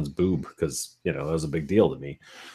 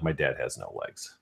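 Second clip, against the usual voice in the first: low, soft deadpan; really serious; 6 semitones lower, 10 dB quieter than usual. Retold or imitated. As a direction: feign grave seriousness to sell the prank. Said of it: "you would go really serious".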